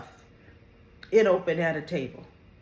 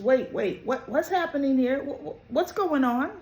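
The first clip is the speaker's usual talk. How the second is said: stammered, higher-pitched mock confusion; bewildered questioning; 6 semitones higher than usual. Lucy interrupting to ask what is happening, bewildered. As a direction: act out someone halting everyone, voice lifted.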